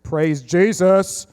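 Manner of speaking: very slow, stretched praise; drawn-out praise. Acted. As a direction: draw out the praise.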